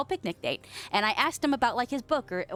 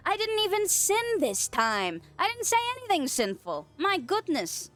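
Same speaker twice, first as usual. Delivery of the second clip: high, whiny yelps and complaints; mock hurt protest; 7 semitones higher, slower than usual. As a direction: yelp "ow" and protest your innocence, drawn out. Mock pain at thrown stream items.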